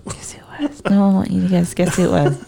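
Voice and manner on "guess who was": teasing stage whisper. Pretend. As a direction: hush it like a secret. Teasing whispered guessing game.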